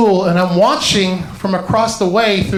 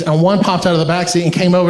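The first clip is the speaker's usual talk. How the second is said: ordinary talk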